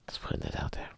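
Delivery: whispered aside. Real, not acted. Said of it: "muttered aside".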